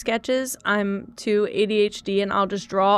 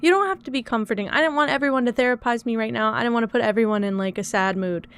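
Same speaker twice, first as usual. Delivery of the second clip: frustrated rush; faster than usual. Real, not acted. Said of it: "I'm frustrated".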